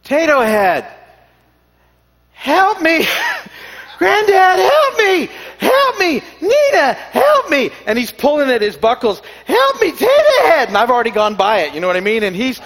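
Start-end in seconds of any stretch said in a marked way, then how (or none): 0.0-3.1 put-on toddler voice. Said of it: "I hear this from Sam". 3.1-3.9 big laugh. Real, not acted. Grandpa laughing at grandson. 4.0-7.7 put-on high, loud child crying help; pleading toddler voice. Imitating grandson crying for help. 9.4-10.7 put-on high, loud child crying help; pleading toddler voice. Imitating grandson crying for help.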